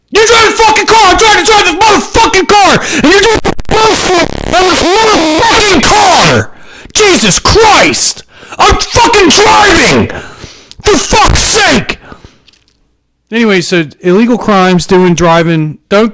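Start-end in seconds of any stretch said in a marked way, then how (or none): 0.1-12.0 screaming road rage. Acted. Driver screaming at the car behind, high furious shriek. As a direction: scream at the tailgater like you've lost it.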